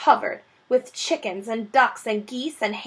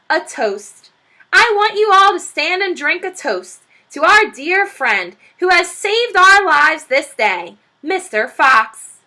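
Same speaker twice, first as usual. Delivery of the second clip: loud, higher, slow proclamation; calling out a toast; 7 semitones higher, 8 dB louder than usual. Acted. Badger toasting his dear friend, calling out grandly. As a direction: raise the glass and call to the room. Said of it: "called out".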